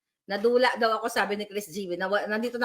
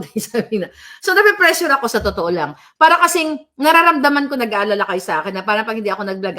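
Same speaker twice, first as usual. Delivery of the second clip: ordinary delivery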